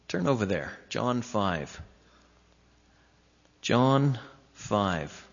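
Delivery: ordinary talk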